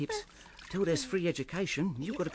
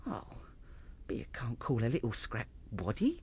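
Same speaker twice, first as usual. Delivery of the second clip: whisper; 8 semitones lower, 5 dB quieter than usual. Acted. Whispering over the baby.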